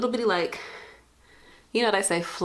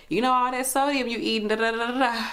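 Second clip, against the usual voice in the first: coworker; 6 semitones higher than usual. Retold or imitated. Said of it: "somebody else come behind her".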